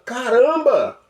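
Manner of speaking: high, fast "aha" rush; excited realization. Pretend. Listener finally gets it, high quick excited cry. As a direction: burst out like it finally clicked.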